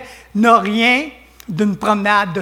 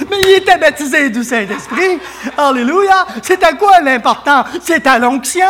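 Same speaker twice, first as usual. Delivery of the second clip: loud, raised-pitch fervent preaching; preaching at full force; 5 semitones higher, 5 dB louder than usual. Real, not acted. Preacher telling the church the anointing matters, full-force preaching. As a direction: drive each phrase home to the congregation, loud.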